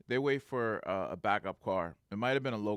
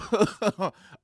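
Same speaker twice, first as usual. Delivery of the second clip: high, loud chuckling burst; real laugh; 10 semitones higher, 10 dB louder than usual. Real, not acted. After imitating the guard's yell, loud laughing. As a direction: laugh helplessly at the absurdity.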